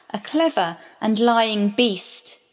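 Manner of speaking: usual speaking voice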